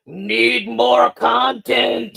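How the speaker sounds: slow, drawn-out greedy boss voice; corporate exec voice. Retold or imitated. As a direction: drag it out like a greedy studio exec. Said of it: "I'm pretending to be Disney".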